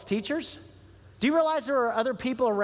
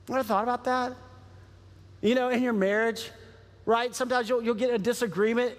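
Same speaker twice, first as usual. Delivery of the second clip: usual speaking voice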